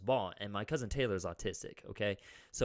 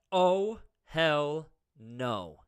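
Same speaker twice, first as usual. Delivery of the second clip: defiant hell no; 5 semitones higher, 9 dB louder, much slower than usual. Retold or imitated. Retold standing up to bully.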